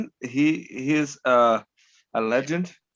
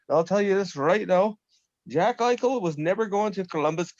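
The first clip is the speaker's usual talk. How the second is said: usual speaking voice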